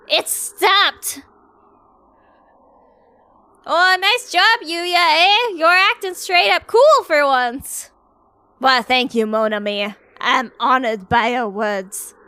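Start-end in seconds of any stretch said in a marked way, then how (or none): 0.1-7.9 high, bright praise; cheerful high girl. Acted. Schoolgirl praising Yuya, high and cheerful.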